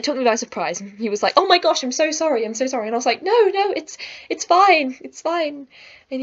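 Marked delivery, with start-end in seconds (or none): 1.4-2.9 high, louder apology easing off; flustered apology. Retold or imitated. Boy apologises after the surprise kiss, flustered. 3.2-5.7 high, loud insistence that eases off; flustered reassurance. Retold or imitated. Girl tells the boy the kiss is fine, flustered. Retelling her hurried reassurance to him.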